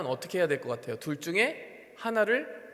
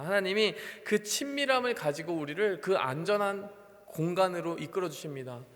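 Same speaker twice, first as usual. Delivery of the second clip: ordinary delivery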